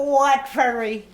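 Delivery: mimicking accented English. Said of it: "where they got that name from".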